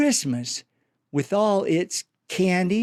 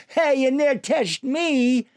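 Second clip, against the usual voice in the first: playful kid holler, lightly voiced; mock holler; 8 semitones higher than usual. A narrator's reading. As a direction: tease them with a cheeky yell. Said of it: "laughs and hollers".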